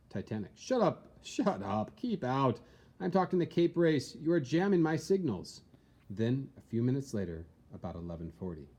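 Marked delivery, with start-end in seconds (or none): 0.6-5.6 higher, sharper brush-off; Titanic's radio operator snapping at the Californian; irritated operator. Acted. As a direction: tell him to get off the line, annoyed. Titanic operator snaps back.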